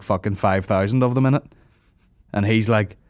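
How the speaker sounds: usual speaking voice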